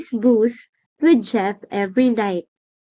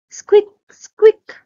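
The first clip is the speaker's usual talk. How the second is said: high, loud frightened squeaks; scared squeaks; 10 semitones higher, 7 dB louder than usual. Acted. Frightened little monster squeaking from under the bed. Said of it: "The poor thing sounded scared".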